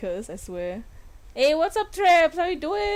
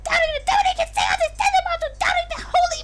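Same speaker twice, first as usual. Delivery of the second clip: Toad voice; faster than usual. Acted. Princess voiced as Toad for the game, fast high screech. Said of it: "she's Toad".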